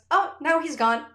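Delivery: put-on high bright child reporting; child's voice. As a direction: squeak it out like a little girl. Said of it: "and she goes".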